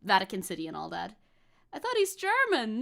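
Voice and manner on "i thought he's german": imitated mistaken listener. Voices someone mistaken about him.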